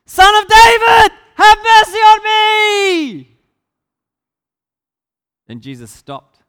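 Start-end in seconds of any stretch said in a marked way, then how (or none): 0.1-3.4 all-out, very high yell with stretched ending; yelling louder. A narrator's reading. Blind beggar defies the rebukes, yelling even louder. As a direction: bellow it at the top of your voice, high and long. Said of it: "But he yelled louder".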